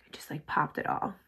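awed whisper. Real, not acted. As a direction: breathe it out in a hushed whisper. Marveling at the highlight effect.

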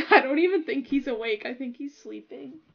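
ordinary delivery

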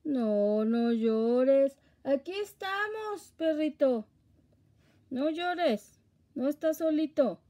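loud, high, slow soothing coo; calm the crying dog, high and loud; comforting a crying puppy. Real, not acted. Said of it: "Consuélalo".

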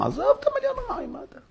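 high, fast, brushing-off squeak; mocking dismissal. Abraham brushes off the million wicked in a fast squeak. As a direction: wave the million away in a squeaky voice. Mock-dismissive aside to God.

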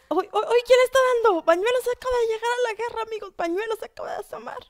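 high-pitched urgent play-by-play; loud burst, staying high as it eases; excited alarm. Real, not acted. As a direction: call the sudden attack excitedly. New player joins the fight.

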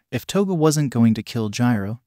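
ordinary delivery, nothing marked

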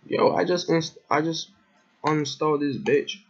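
high, exasperated gamer yelp; exasperated outburst. Real, not acted. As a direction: blurt it out in disbelief. Frustrated gamer fails to uninstall.

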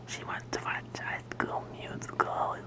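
hushed whisper. Real, not acted. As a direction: keep it to a whisper. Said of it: "gotta be quiet".

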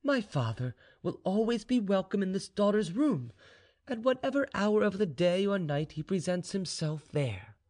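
put-on high-pitched, courteous young woman; high, composed girl's voice; devoted daughter. A narrator's reading. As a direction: welcome your father politely in a high voice. Vaninka welcomes her father.

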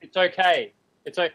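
low, soothing mock comfort; mock consoling. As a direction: console him with teasing calm. Mock consoling of arguing friend.

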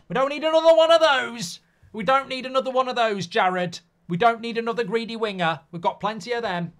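high, loud exasperated yell; complaining loudly about greedy wingers, high and loud; exasperated rant. Acted. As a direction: shout in mock outrage at the winger.

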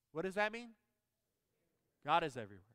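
ordinary talk, nothing marked

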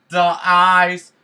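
high, loud surprised cry; excited outburst. Real, not acted. Gamer reacting to ice snapping, high and loud. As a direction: burst out as the ice breaks.

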